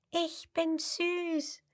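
high, soft, slow squeak; tiny high squeak; little crocodile squeaking. A narrator's reading. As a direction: squeak it shyly like a baby crocodile. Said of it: "quiekt das fünfte".

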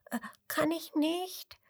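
high, soft, puzzled squeak; small high uncertain question; little crocodile asking. A narrator's reading. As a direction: ask timidly in a tiny voice. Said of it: "fragt das kleine Krokodil".

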